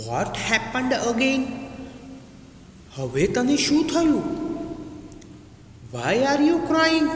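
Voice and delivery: high, drawn-out questioning voice; slow questions pitched well up; forest goddess. Acted. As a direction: act a goddess questioning a crying tree. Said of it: "said વન Devi".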